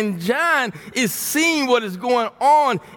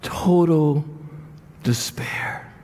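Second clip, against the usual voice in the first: hushed despair; 6 semitones lower, slower than usual. Acted. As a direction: breathe it out in despair. Dwelling on John's despair.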